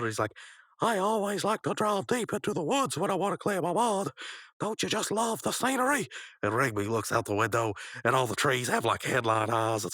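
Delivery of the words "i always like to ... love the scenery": high-pitched dotty cartoon character; high, carefree cartoon voice; Pops. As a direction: pitch it up high, serenely oblivious. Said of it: "and Pops has".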